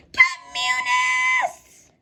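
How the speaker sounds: long drawn-out high shout; stretched, wailing cry of alarm; crowd scream. A narrator's reading.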